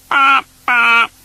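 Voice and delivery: loud high honking bop bop; a sulky toy car hooting; grumpy car horn. Acted. The car honks grumpily in a puddle, loud high hoot. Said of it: "said his car grumpily".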